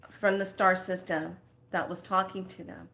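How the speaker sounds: ordinary talk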